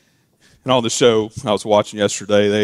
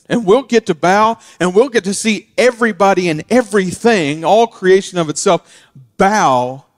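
rising preaching; 6 semitones higher, 4 dB louder than usual. Acted. Preaching climax.

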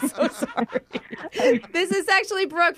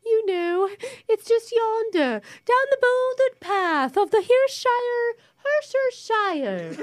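high, slow, absurdly hammed-up British; over-the-top mock British, pitched high; fake British accent; 8 semitones higher, slower than usual. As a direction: stretch it out in a silly British voice.

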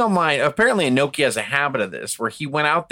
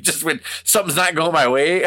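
usual speaking voice

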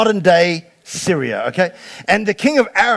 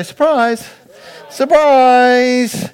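higher, louder cry of surprise; excited surprise; 5 semitones higher, 3 dB louder, much slower than usual. Shown as a demonstration. Preacher leading the congregation, excited. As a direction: call it out for the crowd. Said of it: "Everybody say".

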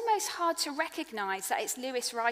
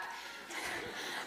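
rueful laugh; 12 dB quieter than usual. Real, not acted. Rueful laugh after confession.

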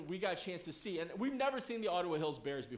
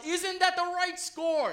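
high, loud, drawn-out chant; cheerleader yell; 9 semitones higher, 8 dB louder, slower than usual. Retold or imitated. Cheerleaders taunt the visiting team, imitated high yell. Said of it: "And then they would yell".

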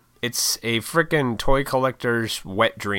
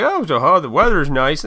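awkward small talk; 5 semitones higher, 5 dB louder than usual. Retold or imitated. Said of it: "I was just like".

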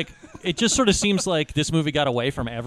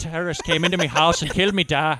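mock Irish accent. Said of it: "Brad Pitt's accent".

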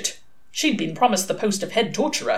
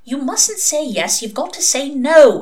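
higher, insistent prompting; urgent hiss; 8 semitones higher, 5 dB louder than usual. A narrator's reading. Head torturer nudging the suspect to say no, hissed. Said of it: "hissed the head torturer".

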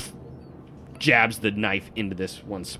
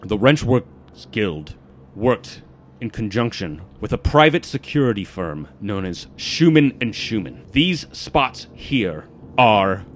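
measured, emphatic briefing with loud key words; intense guild informant; 5 dB louder than usual. Acted. Warns the party about security stones, intense informant. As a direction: spell out the dangers with deliberate force.

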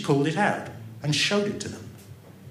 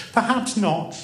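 cheerfully; 8 semitones higher, 3 dB louder than usual. A narrator's reading. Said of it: "the girl answered cheerfully".

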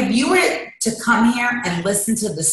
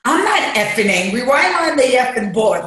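indignant, higher and louder; retold angry protest; 6 semitones higher, 3 dB louder than usual. Acting out walking in to the feelings board, indignant protest. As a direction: act out an indignant denial.

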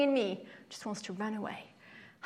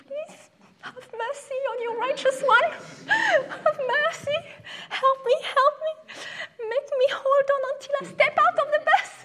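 high, loud, laughing plea to God; desperate prayer; 8 dB louder, faster than usual. Retold or imitated. Girl on the bus praying not to wet herself, high and frantic. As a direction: beg God for mercy in a squeaky comic voice. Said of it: "and I remember being like".

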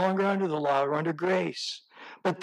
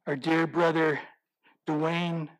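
usual speaking voice

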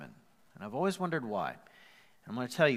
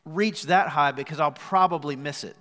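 impassioned fear; 5 semitones higher, 10 dB louder than usual. Retold or imitated. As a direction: voice the listener's fear at full force. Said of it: "So you go".

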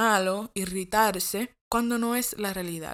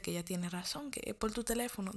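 soft, much quieter, hurried; hushed and quick; 9 dB quieter, faster than usual. Real, not acted. She quietly confirms to the listener that mom is right. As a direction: drop your voice and rush the point. Hushed agreement with mom's warning.

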